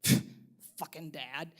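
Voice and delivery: put-on a teen sneering under his breath; disgusted mutter. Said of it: "He goes".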